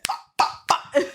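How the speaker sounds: three unvoiced percussive bursts; imitated power blasts. Joking about a secret power raising the dead, imitated blasts. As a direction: puff out mock magic blasts.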